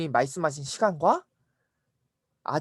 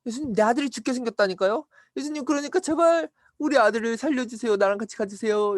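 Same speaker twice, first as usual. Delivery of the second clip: higher-pitched, urgent begging; insistent pleading; 6 semitones higher than usual. Acted. Father begs Jesus to come along, insistent high plea. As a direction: plead harder as you go, acted.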